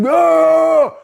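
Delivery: sudden high loud yell; grandfather's yell. Retold or imitated. Grandpa yelling at the kid, loud shout. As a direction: bellow it out. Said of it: "He was yelling".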